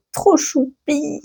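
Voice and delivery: soft, slow doting coo; cutesy gush. Real, not acted. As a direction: coo over something adorable, slowly. Gushing over baby dragon cover.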